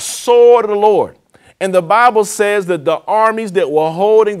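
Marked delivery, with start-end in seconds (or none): 0.0-1.2 loud, higher, drawn-out mock yell; retold battle cry. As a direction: call it out like an army's battle cry, not a full scream. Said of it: "And they yelled out".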